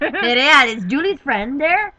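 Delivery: loud, slow Canadian lumberjack voice; gruff booming lumberjack. Retold or imitated. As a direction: boom it out like a woodsman. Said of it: "I sound like a lumberjack".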